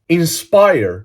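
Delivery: INSPIRE in a fluent English accent; authentic English accent. Shown as a demonstration. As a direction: say "Inspire" like a native English speaker. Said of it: "μιλάω με αυτή την προφορά".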